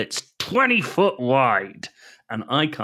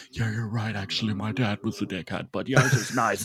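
softer, different-sounding ad voice; different sound quality; 4 dB quieter, faster than usual. Retold or imitated. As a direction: sound like a separate ad recording. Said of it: "a slightly different sound quality of someone being like".

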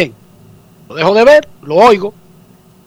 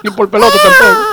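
mock sob; 13 semitones higher than usual. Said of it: "Yo no lloro".

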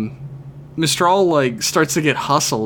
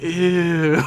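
disgusted; 4 semitones higher than usual. Retold or imitated. Crowd reacting to creep.

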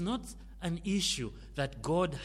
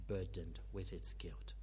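whisper; 9 semitones lower, 12 dB quieter than usual. Acted. Sermon, hushed on guilt.

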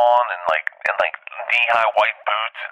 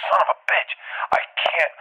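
high, strained mock distress; pained protest; 7 semitones higher than usual. Comic attacked by the DJ's crew, pained protest. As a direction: act out being swarmed, voice pitched way up.

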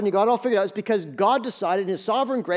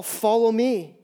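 Jesus calling; 3 semitones higher, 5 dB louder, much slower than usual. Retold or imitated. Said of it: "Here's the call".

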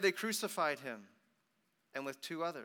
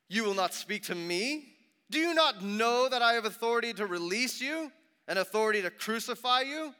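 loud, high, rising questions; indignant challenge; 8 semitones higher, 10 dB louder than usual. A narrator's reading. Pilate to silent Jesus, loud indignant demand. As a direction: demand an answer from a silent prisoner.

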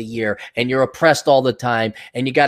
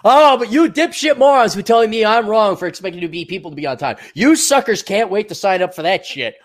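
sneering mock voice; 6 semitones higher, 4 dB louder than usual. Mocking scornful people voiced.

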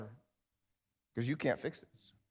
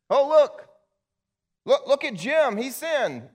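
much higher, louder jeering taunt; mocking accuser; 13 semitones higher, 11 dB louder than usual. The enemy points at Jim and accuses him, higher and louder jeering. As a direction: jeer like the accuser pointing a finger. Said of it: "the enemy comes and points at you and says".

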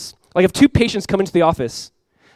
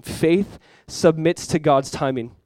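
even slower, weighty repetition; slower repeated point; much slower than usual. Real, not acted. Preacher repeating his fourth point even slower for emphasis. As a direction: repeat it slowly so it sinks in.

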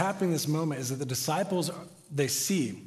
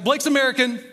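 loud, higher retold outburst; blurted out; 7 semitones higher, 6 dB louder than usual. Host pastor cutting in on colleague's rant, raised blurt. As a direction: blurt it out, imitating a desperate interruption. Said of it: "finally, he blurts out".

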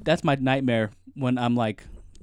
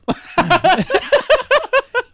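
high burst of laughter; 8 dB louder than usual. Real, not acted. Friend jokes "love.

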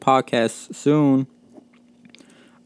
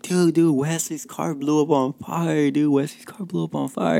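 higher, buzzing retelling; excited gossip; 5 semitones higher than usual. Retold or imitated. Coworkers telling each other about the fire, excited. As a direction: spread the news like excited coworkers. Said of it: "everybody's just like".

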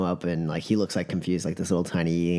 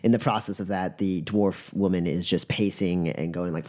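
normal talking voice

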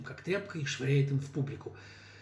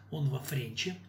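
ordinary delivery, nothing marked

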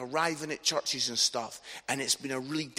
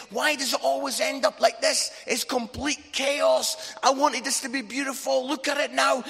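high, raised voice imitating an upset wife; retold shouting; 9 semitones higher, 5 dB louder than usual. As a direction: act out an exasperated wife yelling at the table. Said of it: "my wife's, like, shouting".

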